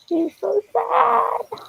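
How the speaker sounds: put-on high, slow baby voice; baby talk. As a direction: coo it like to a baby. Cooing at upset friend.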